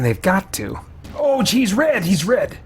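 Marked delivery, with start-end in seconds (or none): 1.1-2.6 sudden high alarmed cry; startled yelp at red enemies. Real, not acted.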